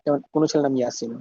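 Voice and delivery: normal talking voice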